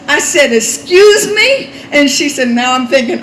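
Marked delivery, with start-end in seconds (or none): 0.5-1.7 loud, drawn-out, high rising retort; bowed-up challenge. Retold or imitated. Preacher retelling how she bowed up on the woman, defiant. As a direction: stretch it out and square up to her. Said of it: "I bowed up on her like this".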